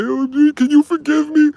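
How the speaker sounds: high, pleading mock sob; imitating her crying. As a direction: beg for forgiveness in a squeaky tearful voice. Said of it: "She was crying and said".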